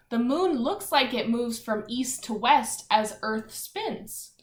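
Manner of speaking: ordinary talk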